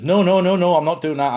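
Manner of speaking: loud, firm no, no, no; retold loud refusal; emphatic refusal. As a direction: shut the request down hard. Refusing friend's request to lie.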